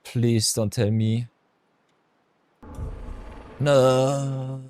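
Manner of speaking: usual speaking voice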